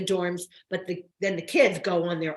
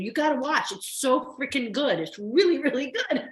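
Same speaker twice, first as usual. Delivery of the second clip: higher, eager pitch of a fan telling friends; excited urging; 7 semitones higher than usual. Retold or imitated. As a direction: gush as you urge people to watch. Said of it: "I feel really good about saying to people".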